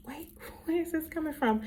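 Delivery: high, bewildered retold reaction; taken aback. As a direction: halt them in high-pitched disbelief. Taken aback by child's insult.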